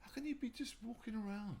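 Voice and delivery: put-on high, incredulous kid voice; baffled kid. Repeating the kid's question to the interviewer, high and incredulous. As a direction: squeak the question like the puzzled boy.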